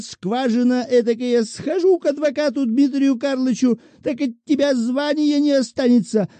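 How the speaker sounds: a whining widow threatening with her rights; defenceless petitioner. A narrator's reading. Shchukina voiced by narrator.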